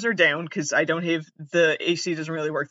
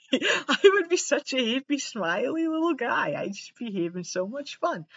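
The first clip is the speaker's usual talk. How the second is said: higher, sweet little guy voice; cutesy happy voice; 7 semitones higher, 3 dB quieter than usual. Pretend. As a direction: play an imagined cheerful self. Imagining happy smiley self.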